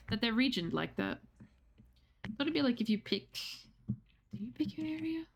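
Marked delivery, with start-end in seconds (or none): 3.9-5.2 breathy whispered question to himself. Real, not acted. Streamer wondering about Twitch regions, whispered. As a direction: drop to a whisper, puzzled.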